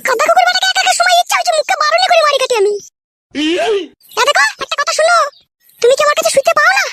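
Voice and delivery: high-pitched scolding woman's voice; a sharp-tongued village wife cutting in; wife's voice. Acted. Village wife cuts in on the men, high-pitched and sharp.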